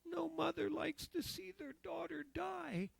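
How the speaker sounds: a hint of a dying mother's voice; voicing his mother. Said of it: "She says".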